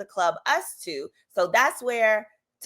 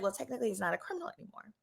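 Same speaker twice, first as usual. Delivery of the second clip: sheepish excuse; 14 dB quieter, much faster than usual. Retold or imitated.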